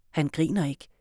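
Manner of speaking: usual speaking voice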